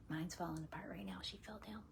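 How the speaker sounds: quick whisper under the breath; a rushed whispered confession; whispered aside. Real, not acted. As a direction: slip in a fast private whisper. Private aside about her mom.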